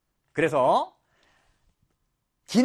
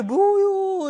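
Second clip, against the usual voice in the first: high, long-drawn hammed-up wail; mock sadness; 10 semitones higher, 6 dB louder, much slower than usual. Shown as a demonstration. Teacher demos Chungcheong dialect for sorrow, mock wail. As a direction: stretch it into a fake sorrowful cry. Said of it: "슬플 때".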